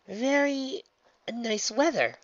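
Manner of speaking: high, halting pleasantry; awkward small talk. A narrator's reading. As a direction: force it out with an uneasy gap. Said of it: "Twilight got out".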